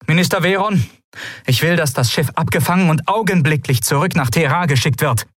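high, sharp command; commanding ruler. Acted. Lien ordering minister Veron to turn the ship back, commanding. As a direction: order it done at once.

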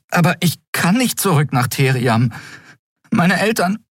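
put-on high-pitched, anxious boy's voice; distressed plea. A narrator's reading. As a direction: plead in dread of being sent back. Boy dreads being sent back. (ängstliches, hohes Flehen)

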